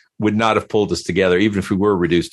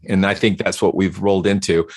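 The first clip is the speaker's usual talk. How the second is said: normal talking voice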